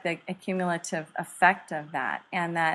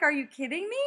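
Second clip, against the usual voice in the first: retold disbelief; 8 semitones higher, faster than usual. Husband's laughing disbelief, retold.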